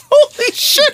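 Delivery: high-pitched, cracking up; helpless laughing reaction; laughing exclamation. Real, not acted. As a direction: blurt it through real laughter. Bursts out laughing at the clip.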